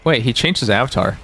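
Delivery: ordinary talk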